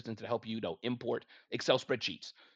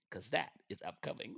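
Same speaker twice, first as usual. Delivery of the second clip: ordinary delivery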